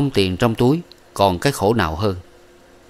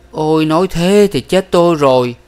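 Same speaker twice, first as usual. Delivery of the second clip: higher, louder anguished cry; lament; 8 semitones higher, 6 dB louder than usual. A narrator's reading. Young man cries out he is ruined, higher and louder. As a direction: wail that he is ruined.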